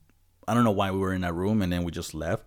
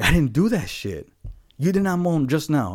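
higher and louder denial; indignant denial; 9 semitones higher, 6 dB louder than usual. Retold or imitated. Denying the moan to coworker, raised indignant voice. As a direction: insist you didn't do it. Retold argument about moan.

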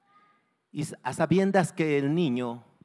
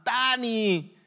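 high, loud calls from a crowd; urgent shouted encouragement; onlookers shouting; 10 semitones higher, 8 dB louder than usual. Acted. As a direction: yell reassurance up to the trapped boy. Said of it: "y gritaban".

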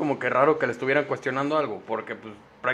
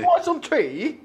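very high mock London accent; squeaky parody of a London accent; 13 semitones higher, 3 dB louder, slower than usual. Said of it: "en intento de acento londinense". (acento londinense agudo y exagerado)

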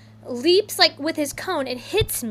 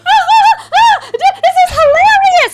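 put-on much higher, loud laughing voice of a giggling child; imitating laughter; 12 dB louder than usual. Daughter laughing at the poop chaos, high and loud. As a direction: laugh through it like a delighted kid. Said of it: "comes out laughing".

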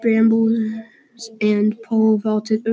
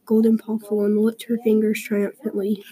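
ordinary delivery, nothing marked

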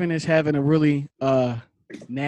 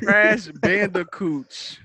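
slow hyped echo; 5 semitones higher, 3 dB louder, much slower than usual. Real, not acted.

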